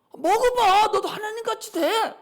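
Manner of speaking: urging devil. Retold or imitated. Devil tempts Eve to eat the fruit, urging loudly. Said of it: "마귀가 와서 속삭인 거예요".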